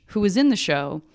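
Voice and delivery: usual speaking voice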